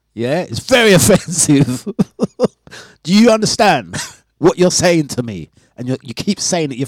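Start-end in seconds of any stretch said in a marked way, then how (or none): 0.1-5.5 laughing mock offence. Real, not acted.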